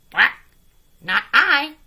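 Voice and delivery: loud quacky refusal; a duck flatly refusing. A narrator's reading. Duck refusing the hen, loud and high. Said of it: "said the duck".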